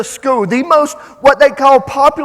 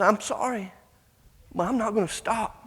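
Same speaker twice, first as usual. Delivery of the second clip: much quieter, contrite acted reply; soft apology; 9 dB quieter than usual. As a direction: drop the volume and apologise with quiet resolve. Boy's soft apology after her outburst.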